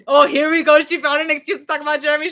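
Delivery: loud exasperated "here we go". Real, not acted. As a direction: groan at the comment, fed up. Reacting to a comment.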